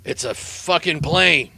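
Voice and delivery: slow, deadpan and unimpressed; dismissive shrug. Acted. The pilot brushes off Mondesso's question with a slow, deadpan shrug. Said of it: "He shrugs and he says".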